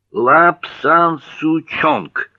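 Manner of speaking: high, loud, very slow syllable by syllable; triumphant recall. Acted. Recalling the name of the tea, loudly and slowly syllable by syllable. Name pieced together at last.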